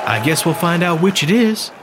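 deadpan sarcastic jab; Emmeline sending Meade back to fight, sarcastic. Acted. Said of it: "Emmeline said sarcastically".

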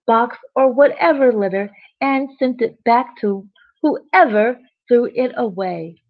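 usual speaking voice

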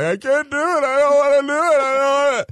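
mock crying kid. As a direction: imitate a scared kid pleading, voice up high. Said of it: "the whole time crying".

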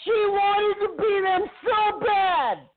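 high shouted rant; shouting. Acted. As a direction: yell it, pitch way up. Ranting about Kaylee, shouting.

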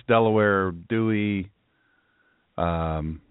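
low, sober, halting delivery; really serious. Real, not acted. Host addresses listeners hit by flooding, low and serious. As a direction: speak low and gravely, with long pauses. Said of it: "Now I'm gonna be really serious".

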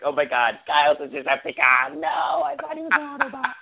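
hammed-up shout, pitch shooting up; mock-dramatic outburst, ending high; mock Transformer panic. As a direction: playfully cry out like an alarmed cartoon robot. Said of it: "That's what it sounded like".

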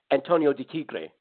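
lightly put-on Spanish accent. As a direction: say it with a hint of Spanish flair.

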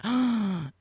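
sharp mock gasp of shock. Friend hears her shot was shared, shocked gasp. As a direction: gasp as if scandalised. Retells reacting to shocking news.

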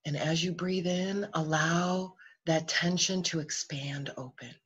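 calm body-scan voice, phrases set apart by long pauses; guided meditation. Real, not acted.

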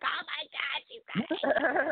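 high-pitched mock overreaction of an emotional dad; super emotional dad. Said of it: "He's, like, super emotional".

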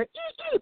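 very high, soft squeal; imitated squeak. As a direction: squeak like a stung little creature. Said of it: "and you hear".

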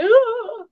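high-pitched mock swooning "ooh"; starstruck swoon. Retelling meeting a childhood crush, high starstruck "ooh". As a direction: squeal like a kid meeting a crush. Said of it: "I was like".

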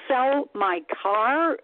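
high, slow stunned echo; incredulous question. Retold or imitated. Told to sell her car by welfare, she echoes it in stunned disbelief. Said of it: "and I'm like".

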